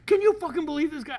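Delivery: high, loud, fast outburst; hammed-up outraged yell; imitated red rage. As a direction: mimic a colleague blowing his top. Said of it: "Got a little red rage".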